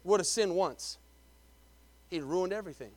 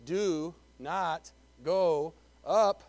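very slow, lower, each word set apart; word-by-word emphasis; 5 semitones lower, much slower than usual. Shown as a demonstration. Preacher has congregation underline four words, slow word-by-word command. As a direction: spell out the command one word at a time. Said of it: "Underline those four words".

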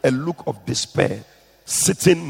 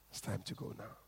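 fading; 5 semitones lower, 25 dB quieter, faster than usual. A narrator's reading. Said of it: "repeated with fade".

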